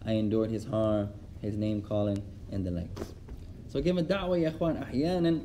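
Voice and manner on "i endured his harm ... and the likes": calm sheikh. Retold or imitated. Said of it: "He said".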